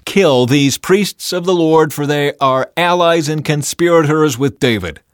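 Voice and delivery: ordering. A narrator's reading. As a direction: order an execution as an enraged king. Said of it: "he ordered his bodyguards".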